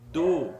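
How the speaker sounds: Bo said loud and clear; syllable demo. Shown as a demonstration. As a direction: call out Bo. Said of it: "Se tivesse um dalet".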